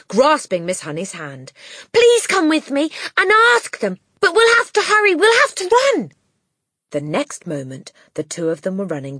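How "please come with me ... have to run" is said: put-on high, loud hurried child; urgent girl. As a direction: urge her breathlessly. Matilda voiced.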